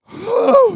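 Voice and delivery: high, loud exclaimed "oh"; big mock-alarmed exclamation; loud recoiling "whoa". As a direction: cry out as if warning people off. Recoiling from that place.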